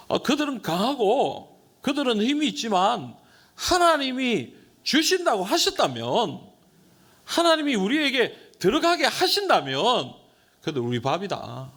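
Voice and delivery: high, loud, bold declaration of faith; Joshua and Caleb. Acted. Joshua and Caleb answering the doubters, high and bold. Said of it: "여호수아와 갈렙은 뭐라고 얘기합니까".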